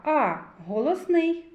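normal talking voice

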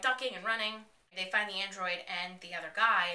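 normal talking voice

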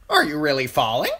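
high, loud bird retort; pert crow. A narrator's reading. Crow asking Bran back, pert high voice. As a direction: toss the question back sharply.

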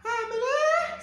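falsetto woman voice. Acted. Man voicing woman in labor.

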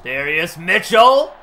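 Joe Buck call. Retold or imitated. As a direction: stretch the name like a TV play-by-play man. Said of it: "That's my Joe Buck impression".

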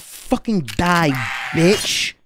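abrupt switch to a darker mock-menacing snarl, slowed; sudden menace. Streamer turning on the lured lock, mock menacing snarl. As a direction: turn on it with a hammed-up threat. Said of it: "I'm like".

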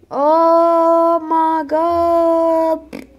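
very slow, loud, high oh my god; shocked. Real, not acted. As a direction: stretch out the disbelief. Drawn-out shock.

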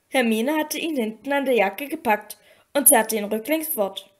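ordinary delivery

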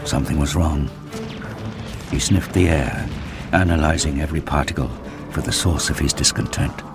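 ordinary talk

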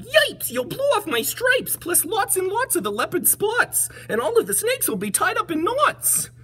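very high, panicky zebra voice; yelping zebra. Acted. Zebra fears losing his stripes, high yelping voice. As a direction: yelp in a squeaky, high-pitched alarm. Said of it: "The zebra yelled".

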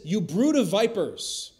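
loud, high, slow denunciation; John the Baptist rebuke. Retold or imitated. Preacher voicing John rebuking the Pharisees, loud and slow. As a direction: thunder it at them slowly. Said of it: "He says".

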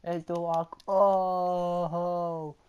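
high, loud match commentary; excited play-by-play. Real, not acted. Gamer yelling commentary as Braun attacks The Rock. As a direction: call the action like a hyped announcer.

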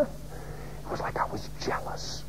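voiceless, intimate admission; whispered confession. Real, not acted. Preacher admitting jealousy to the congregation, whispered. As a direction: whisper it like a secret. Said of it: "It was weird, man".

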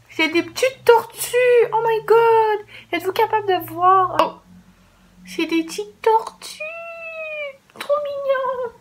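very high, slow, squealing over something adorable; cute squeal. Real, not acted. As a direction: coo like it's the cutest thing ever. Delighted by cute turtle gummies.

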